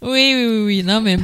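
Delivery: normal talking voice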